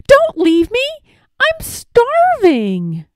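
high, loud wail, stretched slow at the end; pleading call. A narrator's reading. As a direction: plead loudly not to be left. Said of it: "called Wilbur".

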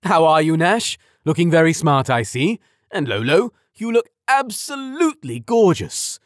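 loud, high, hearty praise; booming voice. A narrator's reading. Father praising his daughter and her date, booming loudly. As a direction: boom it out for the whole street. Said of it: "His booming voice was so loud".

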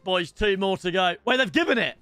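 usual speaking voice

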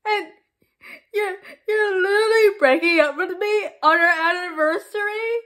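tearful melodramatic pleading; tragic heroine sorrow. Acted. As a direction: sob it out like a soap heroine. Melodramatic breakup, crying.

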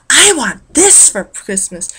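slow, high-pitched bratty demand; drawn-out mock entitled whine; spoiled, rude demand. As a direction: act out a spoiled kid demanding a present. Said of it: "that is very spoiled".

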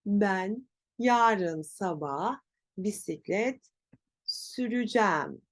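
süreceğim slowly as sü-rü-jem; slow phrase, süreceğim shortened to sürücem; spoken contraction. Shown as a demonstration. Teacher leads repetition, süreceğim as sürücem.

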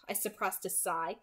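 usual speaking voice

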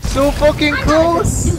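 slow, high, loud groan of frustration; raging gamer. Real, not acted. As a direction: drag it out in agony. Frustrated near miss in fight.

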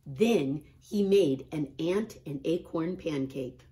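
usual speaking voice

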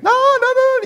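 very high, loud cry of nein; emphatic no. Real, not acted. Preacher loudly stops a misunderstanding of his words. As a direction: shout it down, alarmed.

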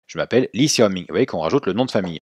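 usual speaking voice

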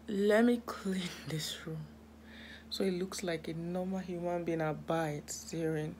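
soft whisper with a laugh; whispered promise to tidy up. Real, not acted. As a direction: whisper it to yourself as you start. Cleaning her room at night.

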